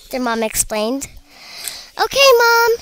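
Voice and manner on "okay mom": high, loud call; cheerful shout. A narrator's reading. Little pea shooters answering their mom, shouting. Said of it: "shouted the three little pea shooters".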